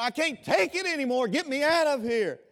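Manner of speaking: loud, high anguished cry; the damned crying out. Retold or imitated. Damned souls pleading from the fire, loud high cry. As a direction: cry out to be let out of hell. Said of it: "when they cry out".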